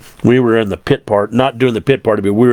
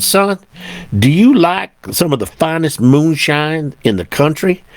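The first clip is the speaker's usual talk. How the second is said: higher-pitched, imperfect Southern imitation; 5 semitones higher than usual. Deputy offers the trucker moonshine, attempted Southern voice. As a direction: put on a Southern deputy, voice lifted on "Son". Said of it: "I can't do a Southern voice".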